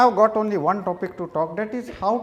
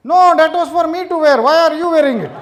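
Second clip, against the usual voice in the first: high, loud, fast shout; mock-outraged wife yelling; 7 semitones higher, 7 dB louder, faster than usual. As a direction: shout it at him in shock. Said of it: "my wife shouted out loudly".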